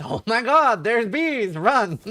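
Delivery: loud, high, frantic bee alarm; mock panicked yell. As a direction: shout it like a panicked elephant warning. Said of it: "And the teacher goes".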